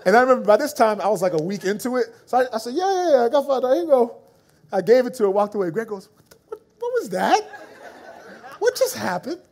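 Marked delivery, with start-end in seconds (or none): 2.7-4.2 eager yes. Retold or imitated. Eager reply to stranger. 6.2-9.4 high, laughing disbelief; half-laughing what just happened; baffled. Retold or imitated. Friend Greg laughs in disbelief, high and baffled.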